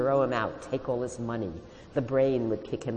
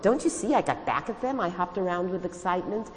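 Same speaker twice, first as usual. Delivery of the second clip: higher, more excited; second take; 8 semitones higher, 3 dB louder than usual. Shown as a demonstration. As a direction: bounce it up with excitement.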